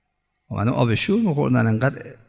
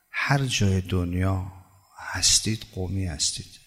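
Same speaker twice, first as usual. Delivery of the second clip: soft, slow emphasis; 6 dB quieter, slower than usual. Acted. Preacher repeats the point again.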